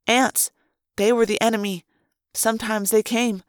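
ordinary delivery

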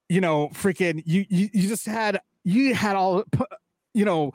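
losing words, stuttering; blanking mid-sentence; blanking on lines. Real, not acted. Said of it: "blanking right now".

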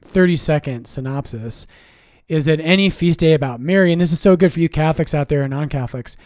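normal talking voice

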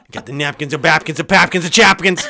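lightly imitated out-of-breath rap, rhyming nonsense; a hint of a winded rapper spitting napkin rhymes; mock breathless rapper. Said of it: "that's how he does it".

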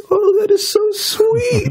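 very high, slow mock coo; hammed-up falsetto swooning; imitating gushing wife. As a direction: squeal it softheartedly like an onlooker moved by romance.

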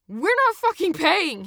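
high, slow, furious-sounding bellow. A narrator's reading. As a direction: bellow a defiant refusal. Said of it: "he bellowed in English".

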